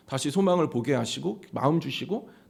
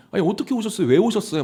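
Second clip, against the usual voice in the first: higher, bewildered asking why they came; surprised; 5 semitones higher, faster than usual. Retold or imitated. Preacher reenacting his flustered welcome to visitors, surprised. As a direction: retell the flustered welcome with amazement. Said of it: "막 제가 오히려 막 놀래가지고".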